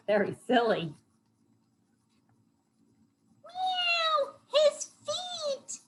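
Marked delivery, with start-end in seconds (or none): 3.4-5.8 mock-horrified cartoon kitty; yelling cat. A narrator's reading. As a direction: wail it in a thin falsetto. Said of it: "yelled Dakota".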